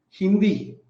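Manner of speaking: ordinary talk, nothing marked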